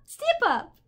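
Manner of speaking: spring the trap with a lilt; a sudden high, loud urging echo; high coaxing push. A narrator's reading. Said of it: "it recommended".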